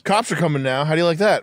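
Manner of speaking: high, taunting mock teacher; vindictive teacher. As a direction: gloat like a teacher who called the cops. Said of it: "a teacher would".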